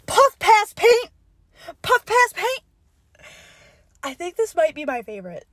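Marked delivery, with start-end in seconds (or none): 0.1-2.6 high, loud, slow "puff pass paint"; excited catchphrase. Real, not acted. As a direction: sell the catchy name. Announcing list item catchily.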